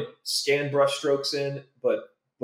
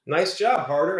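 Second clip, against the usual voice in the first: imitated needling colleague, louder and higher; teasing retold jab, pitched up; mock sarcastic praise; 6 semitones higher, 5 dB louder than usual. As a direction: razz him with fake congratulations. Animators' retold sarcastic remark.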